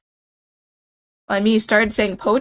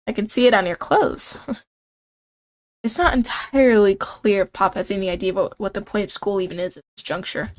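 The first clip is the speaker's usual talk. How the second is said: normal talking voice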